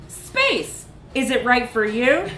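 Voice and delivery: hammed-up brochure enthusiasm; mock pamphlet hype. A narrator's reading. Narrator reading the space pamphlet as a cheesy ad. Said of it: "pamphlets ... that shouted".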